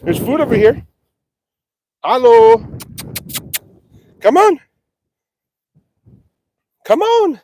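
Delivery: high ringing come-on; calling the cows. Real, not acted. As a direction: coax the herd over. Calling cows toward the bucket.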